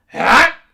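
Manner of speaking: sharp high yell "Hah!"; Link battle cry. Pretend. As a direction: shout a short heroic grunt. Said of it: "can only grunt and scream".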